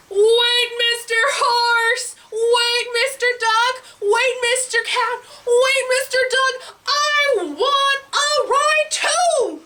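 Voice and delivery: high, drawn-out pleading call; eager pig calling out. Acted. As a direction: call after departing friends as a little pig. Pig calls after the horse.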